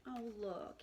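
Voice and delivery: ordinary delivery, nothing marked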